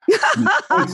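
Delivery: laughing through the words. Real, not acted. Telling the host about the coach, laughing. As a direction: breaks into a high laugh mid-sentence.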